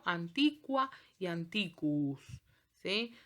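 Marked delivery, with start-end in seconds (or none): 0.0-2.2 "antiqua y antiquus" slowly with kw, an-TI-kwa; Latin QU. Shown as a demonstration. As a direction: sound the U after Q. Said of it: "Por ejemplo".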